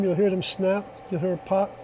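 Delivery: ordinary delivery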